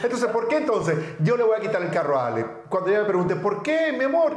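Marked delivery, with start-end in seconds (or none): 3.4-4.4 higher pleading mock wife voice; lightly voiced wife asking why; mimicking wife's plea. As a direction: whine it up high like his wife.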